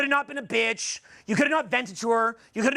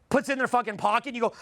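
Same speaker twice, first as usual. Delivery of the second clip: ordinary talk, nothing marked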